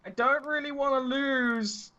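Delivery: yelling across. Acted. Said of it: "this yelling conversation".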